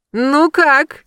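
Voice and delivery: high, loud, thrilled question; delighted squeal. A narrator's reading. Friend bursts in after the séance, squealing with delight. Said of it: "завизжала она от восторга".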